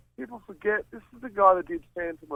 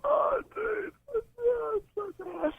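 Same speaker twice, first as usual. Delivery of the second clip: pained whine; slower than usual. Real, not acted. Wincing at the bad singing.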